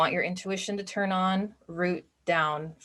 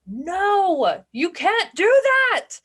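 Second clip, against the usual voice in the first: loud, high-pitched forbidding cry; drawn-out, high mock yell of refusal; loud inner critic; 13 semitones higher, 8 dB louder, much slower than usual. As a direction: shout it down like an overbearing limiting belief. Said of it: "it might be louder".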